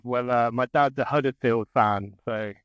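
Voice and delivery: ordinary delivery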